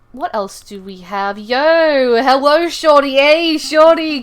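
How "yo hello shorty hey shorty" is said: loud, high hello; excited greeting. Real, not acted. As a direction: shout a happy hello to a friend. Greeting a viewer on stream.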